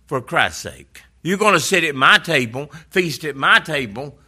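loud, earnest promise of pardon; fervent royal forgiveness. Acted. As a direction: pour forgiveness over him. King voiced forgiving Mephibosheth.

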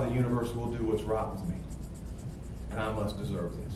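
a touch of tearful self-blame; weeping resignation. Acted. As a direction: quietly accept the sentence. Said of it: "That's what weeping is".

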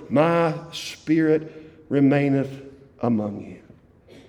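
slow, hushed scripture reading; solemn King James quote, fading; reverent scripture quote. Real, not acted. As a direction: let the verse settle slowly. Pastor quotes scripture slowly.

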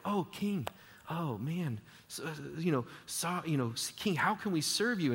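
a hint of a jeering soldier bowing in scorn; mocking soldier. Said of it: "they just can't wait to poke at".

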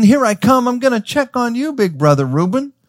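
a hint of a boy bouncing in to check on Reuben; eager kid brother.

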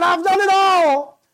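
put-on high, strained boy's cry; a hint of a child's wail, pitched high; desperate boy. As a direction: plead like a worn-out kid. Exhausted boy insists to father.

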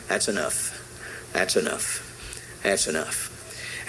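soft, slow whisper, fading each time; whispered repeat. Acted. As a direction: breathe it out like a hushed assurance.

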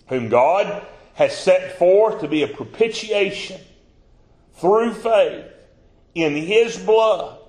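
high, loud, slow scripture reading; preacher reading Romans at full force; preaching scripture. Real, not acted. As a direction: proclaim the verse slowly.

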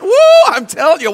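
high, loud woo; excited whoop. Real, not acted. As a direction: whoop with the crowd.

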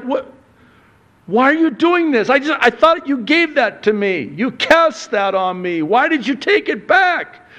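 God asking why you took it back; God exasperated. Acted. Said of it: "God's like".

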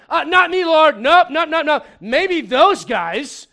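loud, high panicky Peter; hammed-up Peter pointing at others; Peter ducking out. Peter dodging Jesus's call, loud and panicky. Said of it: "Peter's just like".

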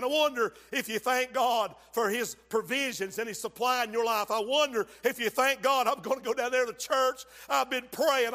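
loud, high, preaching build; full-force preaching. Acted. Preacher telling the congregation of Jesus' prayer, loud preaching. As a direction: preach it to the back row.

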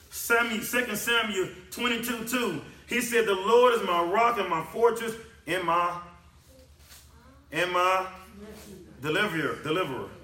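high, loud sermon climax; impassioned preacher in full cry; full-force preaching. Real, not acted. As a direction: preach it loud, pitched well up.

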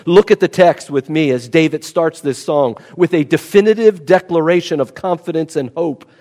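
ordinary delivery

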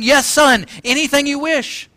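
high, loud proclamation from heaven; big ringing fatherly call; booming Father's voice. Acted. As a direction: boom it out like a father answering his son. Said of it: "Father booming his voice down from heaven".

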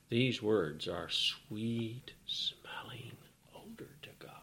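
slow hushed whisper; soft reverent whisper. Acted. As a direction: whisper it slowly, in awe. Preacher's hushed conviction.

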